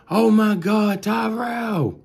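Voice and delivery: high, loud panicked mother's cry; high frantic mother calling out. Retold or imitated. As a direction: scream for your son. Mother screaming for son.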